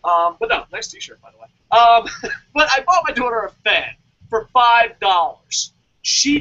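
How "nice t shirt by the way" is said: unvoiced whispered aside; much softer whispered compliment. Real, not acted.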